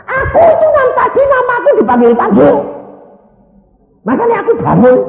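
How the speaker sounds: high, loud acted yell; imitated exasperated husband; indignant shouted protest. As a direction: shout back as the revived patient. Revived husband protesting to nurse.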